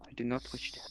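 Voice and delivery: whispering. Acted. Whispering aside to goblin.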